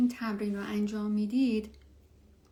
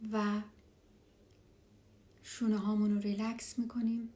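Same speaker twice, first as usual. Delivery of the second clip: hushed and calm, guide them down; spa whisper. Acted. Leads a meditation.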